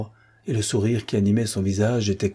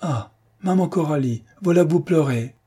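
captain to tearful Coralie, raised affectionate exclamation; fond exclamation; 6 semitones higher than usual. Acted. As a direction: exclaim it warmly as she starts to cry. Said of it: "Patrice Belleval s'exclama".

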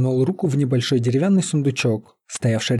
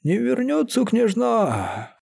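high, wailing «Не вернётся» with «вернётся» lifted; «вернётся» wailed high and drawn out; 10 semitones higher, slower than usual. A narrator's reading. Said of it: "заголосил прорицатель и вдруг заплакал".

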